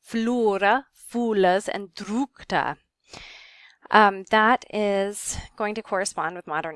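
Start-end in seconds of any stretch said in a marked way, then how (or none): 0.0-2.8 "flora, fulas, drukta" with the vowel as a long oo. Shown as a demonstration. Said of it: "pronounced like a long U".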